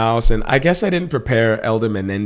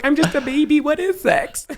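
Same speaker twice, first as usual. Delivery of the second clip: put-on squeaky baby voice. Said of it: "He was like".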